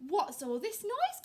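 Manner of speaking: lightly voiced clucking hen. A narrator's reading. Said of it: "clucked Henny Penny".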